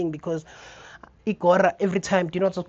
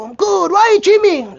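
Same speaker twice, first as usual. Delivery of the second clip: high, loud pushy question; gym guy asking; 13 semitones higher, 10 dB louder than usual. Retold or imitated. Gym regular asking why he gyms, high and loud. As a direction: demand it like an eager gym regular.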